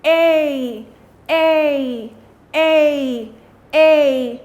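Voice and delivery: A called out loud, stretched; drilled long A. Shown as a demonstration. Teacher calling out the A sound for students, loud. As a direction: call A out for the class. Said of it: "and the action of A is this".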